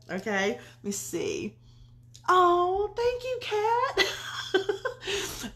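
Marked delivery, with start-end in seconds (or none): 2.3-5.5 high-pitched, giggly squeal of gratitude; drawn-out high thank-you dissolving into giggles; laughing thanks. Real, not acted. Touched thanks to viewer, laughing.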